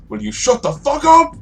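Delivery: high, loud angry shout; exasperated yell. Acted. Man leaning out at the shrieking woman, exasperated yell. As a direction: yell at her to be silent. Fella leans out, swears at her.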